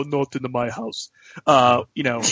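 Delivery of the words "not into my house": lightly voiced catchphrase imitation; softer, mock finger-wagging warning; Dikembe Mutombo. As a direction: imitate a shot-blocker's taunt. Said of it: "He would wag his finger and go".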